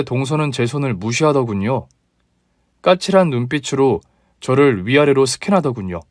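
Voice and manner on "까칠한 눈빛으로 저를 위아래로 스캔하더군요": ordinary delivery, nothing marked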